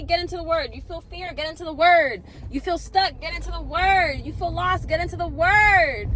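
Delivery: loud, high preacher cadence, hammering the refrain; preaching. Acted. Delivering the day's message to viewers, loud, high-pitched preaching. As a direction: preach it like a pulpit sermon. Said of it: "my little ted Talk".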